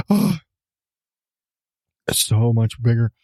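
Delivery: usual speaking voice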